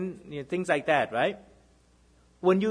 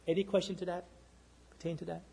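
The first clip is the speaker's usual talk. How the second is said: soft trailing query; 10 dB quieter, faster than usual. Acted. Trailing rhetorical check.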